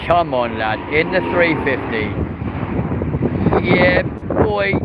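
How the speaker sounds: loud, high cheering on; excited cheer. Real, not acted. Plane spotter cheering an A three fifty in to land, excited.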